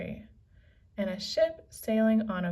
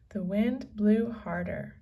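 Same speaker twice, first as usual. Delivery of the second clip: plain reading voice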